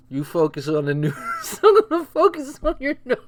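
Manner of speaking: can barely say it for laughing; laughing through quote. Retold or imitated. Repeating the joke while laughing.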